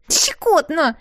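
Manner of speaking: high squeal held back by a giggle; stifled giggle. Acted. Bracelet being picked at with a knife, stifled giggle. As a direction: giggle it out, choking the laugh down. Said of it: "хихикнул сдавленно браслет".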